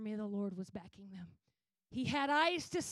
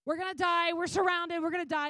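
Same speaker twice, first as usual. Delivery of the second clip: loud, raised mock panic; freaking out; 5 semitones higher, 8 dB louder than usual. Boy to Elisha, surrounded by the army, freaking out. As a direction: panic like a frightened boy in a retelling. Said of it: "the little boy freaks out".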